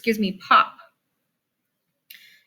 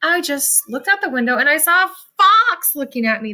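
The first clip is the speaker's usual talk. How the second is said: higher-pitched, playfully excited telling; pretend excitement; 4 semitones higher, 3 dB louder than usual. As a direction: act thrilled at an unusual sighting. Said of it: "And I'm pretending".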